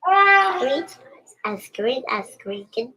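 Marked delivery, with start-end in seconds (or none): 0.1-1.0 loud high shout. Real, not acted. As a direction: shout it out with glee. Child shouts line back.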